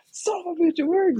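as if the car finally started on its own; amazed disbelief. Retold or imitated. Coworker's engine finally starts, high incredulous yelp. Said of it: "He's like".

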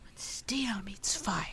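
breathy whisper, slow. Pretend. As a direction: whisper the riddle mysteriously. Whispered repeat of riddle.

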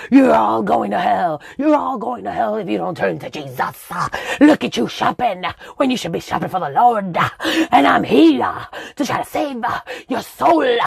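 hammed-up corner preacher shouting at shoppers; street preacher. Street preacher on a Brooklyn soapbox yelling at shoppers, mock fire and brimstone. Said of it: "those preachers on the corner".

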